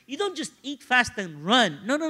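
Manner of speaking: ordinary talk, nothing marked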